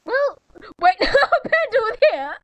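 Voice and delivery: ordinary talk, nothing marked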